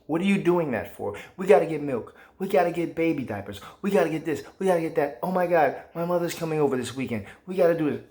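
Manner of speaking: high, pushy nagging wife voice; mock nagging wife. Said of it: "without her saying".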